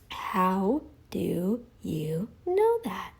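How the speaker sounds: a slow, breathy taunt; slow taunting whisper. Acted. Vlogger taunting absent critic Bart K, slow whisper. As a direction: whisper it very slowly, drawn out.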